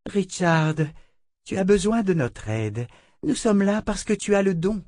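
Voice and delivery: sister Grace. A narrator's reading. Grace urges Richard to accept help, high and earnest. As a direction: press him gently.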